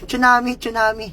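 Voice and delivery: tsunami called out high and loud, like the names misheard; mock shout of tsunami. Shown as a demonstration. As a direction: blur Chona May into tsunami. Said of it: "Pag pinagsama". (sigaw na tsunami)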